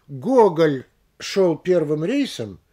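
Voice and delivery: «Гоголь» медленно, громко, с подъёмом и паузой после; выдели «Гоголь» голосом, как название, и отдели паузой; выделение кавычек. Shown as a demonstration. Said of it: "повышение голосовое, и пауза". (неторопливо, с нажимом на «Гоголь»)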